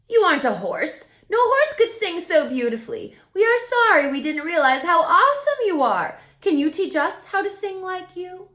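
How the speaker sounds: loud, high eager horses; horses' voice. A narrator's reading. Horses apologise and beg Jazz to teach them.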